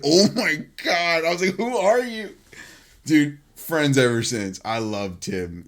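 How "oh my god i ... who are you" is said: retold astonishment. Retells own stunned reaction.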